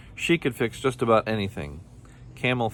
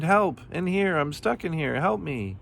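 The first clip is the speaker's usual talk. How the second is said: high, wailing calls for help; screamed; 9 semitones higher than usual. A narrator's reading. Kangaroo locked in the car wash, screamed. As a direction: cry out urgently, pitched way up. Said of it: "he screamed".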